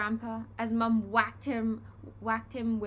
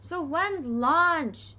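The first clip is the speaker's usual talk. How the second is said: slow, loud, high bellow; 7 semitones higher, 7 dB louder, much slower than usual. A narrator's reading. Henry bellowing at his parents, loud and slow. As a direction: drag it out and roar at the parents. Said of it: "bellowed horrid Henry".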